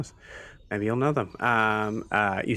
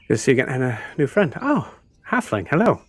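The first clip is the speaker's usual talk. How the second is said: delighted greeting; 5 semitones higher, 5 dB louder, faster than usual. Acted. Elf greets the halfling warmly.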